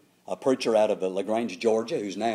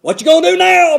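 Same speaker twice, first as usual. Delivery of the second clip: put-on loud, high gravelly old preacher bark; loud raspy old preacher voice; impression of Brother Miller; 13 dB louder than usual. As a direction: boom it out again like an old-time preacher. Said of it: "He kinda had a gravelly, loud voice".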